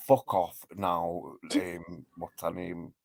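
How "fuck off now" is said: usual speaking voice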